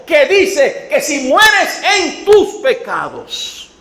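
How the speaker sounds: loud, slow, hammering sermon call; slow and loud, every word a warning; full-force preaching. Acted. Preacher urging listeners to heed scripture, loud slow preaching.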